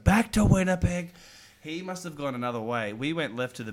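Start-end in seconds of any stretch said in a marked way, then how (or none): 0.0-1.1 mock gruff shout. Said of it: "was like".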